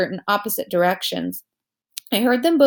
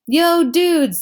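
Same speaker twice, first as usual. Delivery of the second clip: excited greeting; 8 semitones higher, 6 dB louder than usual. Acted. Jack greets friends, hand up.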